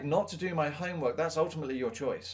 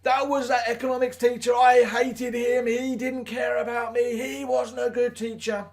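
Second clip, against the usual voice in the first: mock whining complaint; 10 semitones higher, 7 dB louder than usual. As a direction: blame the teacher in a put-on, hammed-up wail. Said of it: "I was like".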